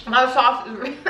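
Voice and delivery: laughing. Real, not acted. Laughing at spicy sauce.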